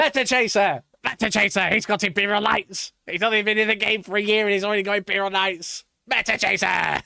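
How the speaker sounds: loud mocking chant; teasing shout. Real, not acted. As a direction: call him out loudly.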